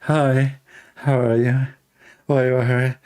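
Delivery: lightly mocking camp voice; drawn-out mock-camp greeting; imitating effeminate caller. Retelling yesterday's prank call, lightly mimicked effeminate voice. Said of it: "using a voice of a, of, of a homosexual".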